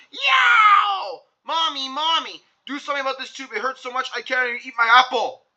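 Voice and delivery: high yowl dropping to a whine; yelp, then beg mommy; child yelling in pain. Acted. Andrew to his mother about his tooth, high pained yell. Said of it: "and yelled".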